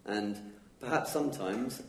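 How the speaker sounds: ordinary talk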